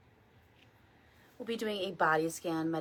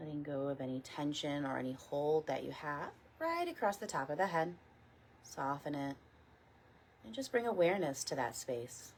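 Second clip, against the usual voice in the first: guided meditation. Real, not acted. Teacher leading a morning body scan, calm guided meditation. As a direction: guide them gently, unhurried, letting each phrase settle.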